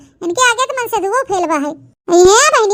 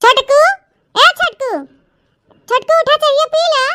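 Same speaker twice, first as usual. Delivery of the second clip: raised voice, calling a sleeper awake; calling out to wake him; 3 semitones higher than usual. Acted. Sister-in-law wakes Chhotku for morning tea, calling out.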